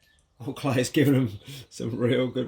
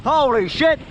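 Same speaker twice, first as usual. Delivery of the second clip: shocked; 11 semitones higher, slower than usual. Real, not acted. Reacting to broken seat.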